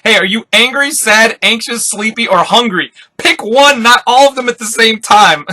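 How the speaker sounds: acted-out scolding yell. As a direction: yell it at him in exasperation. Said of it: "Zoro starts yelling at him".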